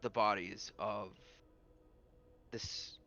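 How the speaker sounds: very halting, grave speech with long pauses; solemn lord character. Acted. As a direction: honor the dead, a few words at a time. Game master voices the lord.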